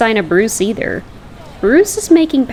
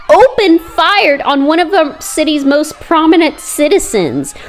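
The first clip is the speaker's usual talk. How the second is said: news report; 5 semitones higher than usual. Acted. Reporter covering riot live.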